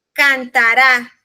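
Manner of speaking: cantará slow and loud, landing on the final RÁ; stress on cantaRÁ. Shown as a demonstration. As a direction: hit the last syllable of cantará hard. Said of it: "la entonación está en la". (cantará con la última sílaba fuerte)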